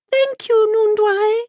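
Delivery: very high, chirping sing-song; singsong bird voice. A narrator's reading. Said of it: "sang the bird".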